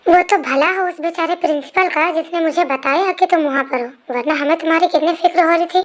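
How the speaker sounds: ordinary delivery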